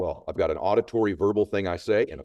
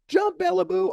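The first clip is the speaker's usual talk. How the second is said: very high-pitched urging call; 3 dB louder than usual. Retold or imitated. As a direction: re-enact the cheering from the boats. Crowd urging her again.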